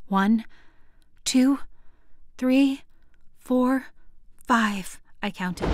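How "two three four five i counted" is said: usual speaking voice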